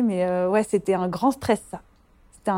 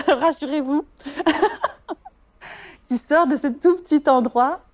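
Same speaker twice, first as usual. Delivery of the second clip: laughing through the words, pitched up; 6 semitones higher than usual. Real, not acted. Midwife laughing at her own slip to listeners, high giggly laugh. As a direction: giggle as you correct yourself.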